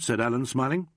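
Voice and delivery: ordinary delivery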